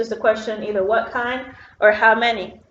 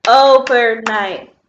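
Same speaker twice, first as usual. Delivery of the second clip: "Overnight" slow and loud in three beats, o-ver-night; split into syllables; 8 dB louder, much slower than usual. Shown as a demonstration. Teacher clapping syllables with pupils, "Overnight" in three slow loud beats. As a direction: punch out each syllable of "Overnight", slowly. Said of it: "clap with me on the syllables".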